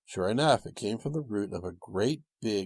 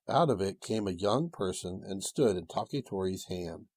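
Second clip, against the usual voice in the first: ordinary delivery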